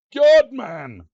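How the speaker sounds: high, loud stunned cry; loud amazed outburst; shocked exclamation. Real, not acted. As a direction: blurt it out in disbelief. Shocked at huge mansion.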